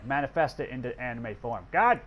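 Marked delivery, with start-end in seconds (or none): 1.7-2.0 loud frustrated curse, higher pitched; angry outburst. Real, not acted. Gamer cursing his failing units, angry outburst. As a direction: let the anger burst out. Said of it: "That's my anger right there".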